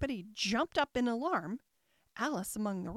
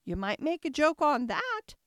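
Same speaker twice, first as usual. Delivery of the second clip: high, small insect voice; little voice; 5 semitones higher, 5 dB louder than usual. A narrator's reading. Gnat suggesting a joke in Alice's ear, little high voice. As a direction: lift it into a tiny creature's pitch. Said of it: "said the little voice close to her ear".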